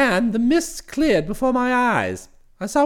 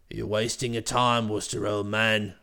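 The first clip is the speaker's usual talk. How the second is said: much lower, hearty brash chap; cheery voice; 11 semitones lower, 5 dB quieter than usual. Acted. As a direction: hail him with cheery familiarity. Bingley voiced again.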